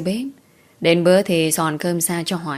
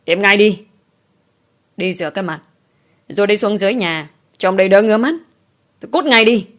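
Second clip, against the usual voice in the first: furious shout; 3 semitones higher, 4 dB louder than usual. Acted. Father ordering his daughter away, furious shout. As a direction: bark her out of the room.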